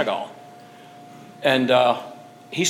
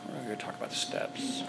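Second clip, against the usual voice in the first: whispered aside; 3 semitones lower, 15 dB quieter than usual. Real, not acted. Aside away from the mic.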